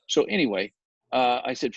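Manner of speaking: usual speaking voice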